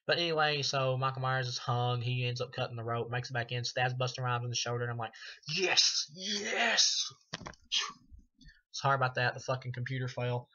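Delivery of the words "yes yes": higher, louder cheer "Yes! Yes!"; excited cheering. Retold or imitated. Horror fan re-enacts cheering at the stabbing, excitedly. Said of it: "I was getting too excited".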